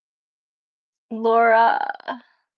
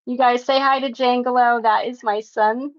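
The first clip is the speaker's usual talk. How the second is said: ordinary talk, nothing marked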